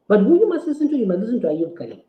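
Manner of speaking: ordinary delivery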